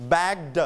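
"bagged" as bag-duh; separated final d. Shown as a demonstration. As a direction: release the d of "bagged" as its own sound. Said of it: "we pronounce the".

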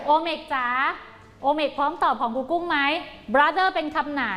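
ordinary delivery

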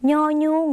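"ย่อยุ่ง" high, as nyo-nyung; nasal Lao ny. Shown as a demonstration. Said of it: "ออกเสียงขึ้นจมูกเป็น".

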